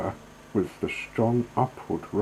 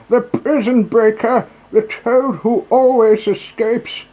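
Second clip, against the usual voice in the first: reckless boastful shout; 14 semitones higher, 12 dB louder than usual. Acted. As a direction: crow it out like a conceited madman at the wheel. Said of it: "he shouted recklessly".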